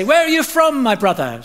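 high, loud sing-song call; imitated market trader calling out; souk shopkeeper. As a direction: hail a passing buyer from the doorway. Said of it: "shopkeepers would stand in the doorway".

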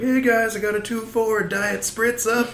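high, hyped party arrival; mock-excited guy arriving with soda; excited party guest. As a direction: burst in like the fun guy at the party. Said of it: "I still like this".

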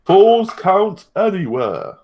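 slow, high, drawn-out match announcement; mock announcer. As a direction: stretch every word like a ring announcer.

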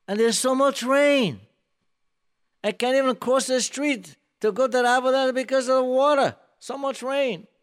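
high, loud taunting voice; mocking. King Ahab mocking Eliyahu Navi about rain, loud high taunt. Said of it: "in a mocking way".

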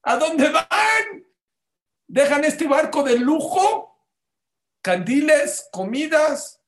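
burlón, agudo y lento, con gritos de desprecio; burla altanera. Retold or imitated. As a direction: se ríen de los que huyen en balsa, muy agudo. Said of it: "que le decía a los de las balsitas". (pasajeros del barco burlándose de los de las balsas, voz aguda y arrogante)

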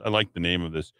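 normal talking voice

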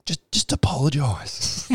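mock James Brayshaw. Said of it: "James Brayshaw just going".

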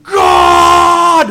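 slow sung line that bursts into a high, loud scream on God; sung croon exploding into a screamed God; building to a scream. Retold or imitated. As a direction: sing it slowly, then scream the last word. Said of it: "And he screams".

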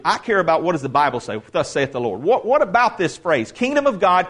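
ordinary talk, nothing marked

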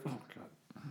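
pained groan under the breath; stomach cramps. Real, not acted. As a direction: wince through a cramp. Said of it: "Yeah, my stomach hurts".

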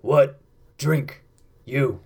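a lightly voiced gruff half-orc barman; half-orc bartender. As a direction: grunt the short question like a simple orc. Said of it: "He looks you up and down and says".

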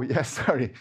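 normal talking voice

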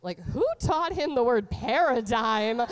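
ordinary talk, nothing marked

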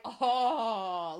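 loud, scandalized "oh" in imitation; mock shocked gasp. Mom hearing toddler swear, mock shocked outcry. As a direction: act out a mother aghast.

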